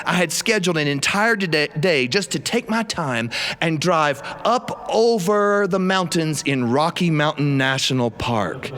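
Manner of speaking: usual speaking voice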